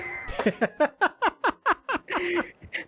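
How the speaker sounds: long high burst of laughter; can't hold the laugh in; high cackling laugh. Real, not acted. Laughing hard at the brony story he tells.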